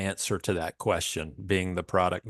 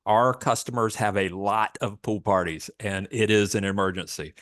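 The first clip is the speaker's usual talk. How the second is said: usual speaking voice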